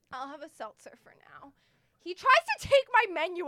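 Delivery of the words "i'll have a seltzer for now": quick defeated whisper. Retold or imitated. Ordering a seltzer from the waiter in a defeated whisper. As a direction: breathe the order out, beaten.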